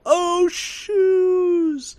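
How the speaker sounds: high, drawn-out mock yell; imitated yell. Host imitating Biff's crew hitting manure, high mock yell. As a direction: yell it out like a movie crash, hammed up. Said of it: "and they yell".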